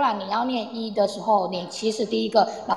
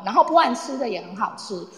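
usual speaking voice

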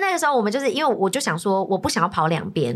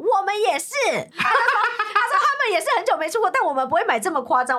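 laughing retelling; 11 semitones higher than usual. Retold or imitated. Retelling officer's mocking reply.

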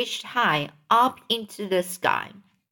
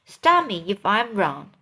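usual speaking voice

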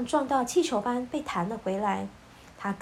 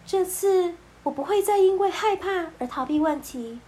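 put-on determined young woman; 7 semitones higher, 4 dB louder than usual. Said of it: "艾莎对着安娜说".

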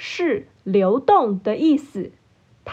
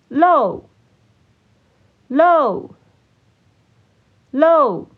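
repeat-after-me drill; much slower than usual. Shown as a demonstration. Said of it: "请大家跟我一起念三次". (跟读示范，一词一顿)

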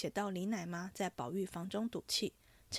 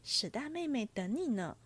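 lively storybook reading with voiced characters; animated novel reading, a touch higher and brighter in pitch; story narration; 4 semitones higher, 4 dB louder than usual. A narrator's reading. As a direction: read the chapter like a storyteller. Reads novel chapter aloud, higher.

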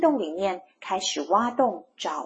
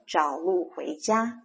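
ordinary delivery, nothing marked

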